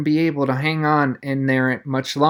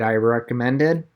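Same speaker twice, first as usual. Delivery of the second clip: usual speaking voice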